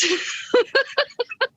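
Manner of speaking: a bright burst of real laughter, high up. Real, not acted. Talking about staying positive with MS, a high real laugh.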